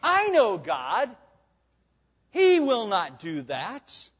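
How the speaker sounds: slow, smug claim of inside knowledge; lightly voiced sly tempter, unhurried; knowing serpent. Pastor voicing the serpent boasting to Eve, slow and sly. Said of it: "backs up his claim with what appears to be a certain special knowledge of God".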